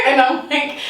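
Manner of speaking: ordinary talk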